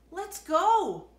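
high loud excited let's go; eager shout. A narrator's reading. The kids rushing off to the neighbor, excited shout. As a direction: burst out eager to leave.

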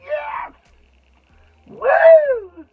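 real triumphant yell; ecstatic shout. Real, not acted. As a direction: scream with joy and relief. Relief product intact, celebrates.

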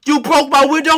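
high, loud furious yell; angry yell. Acted. Chef yelling at Bowser Junior over broken window, furious. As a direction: yell at the kid for breaking the window.

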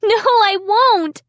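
high, loud, drawn-out refusal; crying out. A narrator's reading. Child refusing to be the dummy's slave, crying out. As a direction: cry out slow and defiant. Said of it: "I cried".

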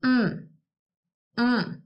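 a single short closed-mouth hum; hummed syllable. Shown as a demonstration. Teacher hums car for the class. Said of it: "Let's hum it".

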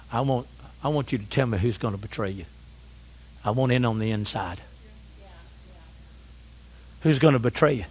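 low, soft, nosy aside; hushed, low acted question; confidential asking. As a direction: drop right down as if asking for a secret. Acts Peter's confidential question.

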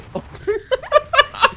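high laugh. Real, not acted. Teammate cracking up during the game, high laugh. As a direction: burst of high giggling.

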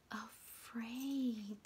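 unvoiced fearful hush; hushed afraid whisper; scared whisper. Shown as a demonstration. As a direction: whisper it as if frightened.

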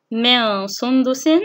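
slow من سندس, nasal noons held; ikhfa demonstration. Shown as a demonstration. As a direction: hum from من into سندس. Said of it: "من كلمتين". (إخفاء النون بغنّة في من سندس)

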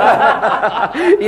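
high rolling laugh; hearty laugh. Acted. Sailor laughing along with his mates.

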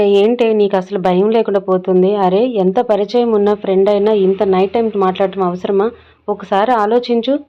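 loud, impatient scolding of a friend. A narrator's reading. Dhruthi scolding Nandu for late-night calls, loud and impatient. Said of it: "అసహనంగా".

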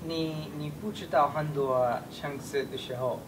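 ordinary talk

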